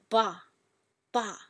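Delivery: short "ba", falling from the top; falling fourth tone. Shown as a demonstration. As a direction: say "ba" with a firm fall. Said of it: "the fourth tone".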